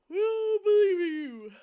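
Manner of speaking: high, slow, loud, almost crying; choked-up comforting promise; tearful reassurance. Acted. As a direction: push the words out through tears. Friend comforts the crying player.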